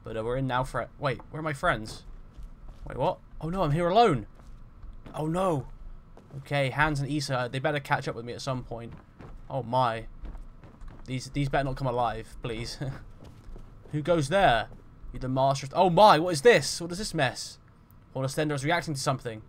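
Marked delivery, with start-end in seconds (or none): 2.9-5.7 loud, high-pitched cry of alarm; played-up panic. Finds companions gone in game. 13.9-14.7 loud challenge into the unknown; role-played sentry's challenge; calling out a challenge. Challenges unseen presence in game. 15.7-17.6 loud, high burst of shock; played-up shocked outcry; startled. Startled by sight in game.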